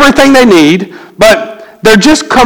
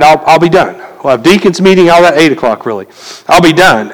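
ordinary talk, nothing marked

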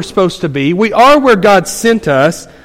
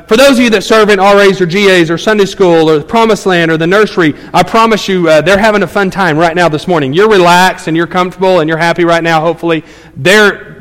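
ordinary talk, nothing marked

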